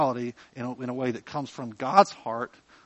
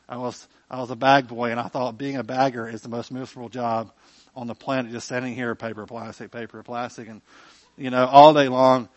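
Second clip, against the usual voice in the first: usual speaking voice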